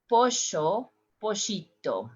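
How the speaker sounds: Pollo, pollito with sh, "posho"; sh sound. Shown as a demonstration. Teacher demos chick with sh. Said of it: "we said".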